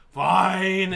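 loud, reluctant give-in; grudging. Retold or imitated. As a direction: throw out a sulky fine. Grudging reply to parents.

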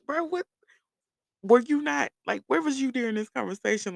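high-pitched exasperated questioning; incredulous. Retold or imitated. Retold disbelief at friend.

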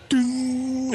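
high, sustained "ding"; high ringing mimicry; imitated streetlight noise. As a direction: ring out like a light switching on. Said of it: "it'd just be like".

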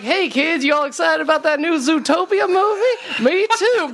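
put-on high, chirpy kids' presenter; kids host voice. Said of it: "I'm like".

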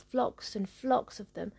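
ordinary delivery

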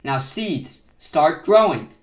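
loud command building to a full shout. A narrator's reading. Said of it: "and shouted".

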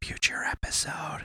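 whispered aside. Real, not acted. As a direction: whisper it under your breath.

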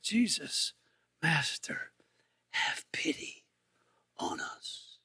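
pleading in a faint whisper. Retold or imitated. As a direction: fade into a desperate whisper. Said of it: "Guess what these ten lepers said?".

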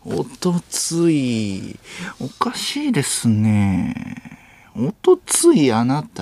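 very slow, suspicious sleuth caricature; 粗品任三郎. Acted. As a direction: draw out each phrase as if cornering a suspect. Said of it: "粗品任三郎さん。". (刑事のものまねで、ゆっくり疑うように)

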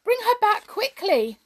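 high, loud, distraught cry; frantic demand. A narrator's reading. King calling for missing Perenelle, frantic. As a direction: cry out in panic for a lost loved one.

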